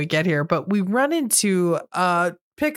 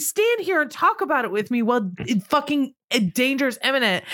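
high, whiny mock disbelief; mock clueless girls; 7 semitones higher than usual. Mocking the movie girls dawdling while danger nears, high and whiny. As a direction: play it as a dim, fussy complaint. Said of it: "They're like".

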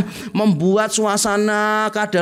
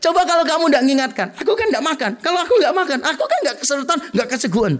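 much higher, fast petulant whine with a laugh; whiny blaming boyfriend; 8 semitones higher, faster than usual. Acted. Boyfriend blames girlfriend, high petulant whine. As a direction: whine the silly logic at her, half laughing.

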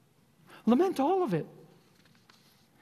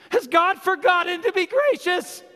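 raised, high-pitched cry of lament to God; anguished protest; 6 semitones higher, 4 dB louder than usual. Acted. Preacher crying out the psalm's complaint to God, anguished protest.

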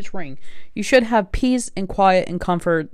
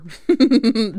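quick run of giggles; amused laugh; 5 semitones higher, 4 dB louder than usual. Real, not acted. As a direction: laugh at your own advice. Laughs at own drink water tip.

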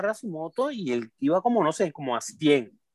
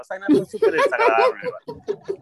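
high, loud burst of disgust; a much higher yelp of disgust; disgusted outburst; 5 dB louder than usual. Real, not acted. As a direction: blurt it out, still grossed out. Recalling a disgusting ride.

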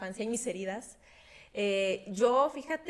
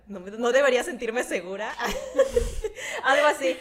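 laughing; 3 semitones higher, 8 dB louder than usual. Real, not acted. Laughs at compliment about confidence.

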